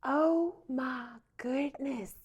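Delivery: a light Southern drawl, Oh my goodness stretched out slowly; Southern accent. Retold or imitated. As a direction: imitate the Southern lady, slow and lilting on "goodness". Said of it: "super Southern".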